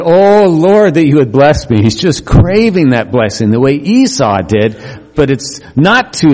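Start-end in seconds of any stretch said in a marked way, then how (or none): 0.0-1.8 pleading prayer. Acted. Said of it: "And he said".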